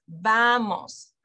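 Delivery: "vamos" with a long, stressed VA; teacher showing VA-mos emphasis; stress on first syllable. Shown as a demonstration. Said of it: "that's where you put the emphasis".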